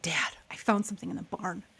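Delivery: soft unvoiced whisper to dad; secret whisper. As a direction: let him in on a secret, hushed. Child telling dad a secret.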